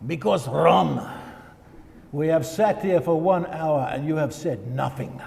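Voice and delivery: put-on a light stoic Finnish accent with a Russian hint. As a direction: lightly voice the Finnish pastor's deadpan reply in his accent. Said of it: "very stoic, almost Russian accent to it".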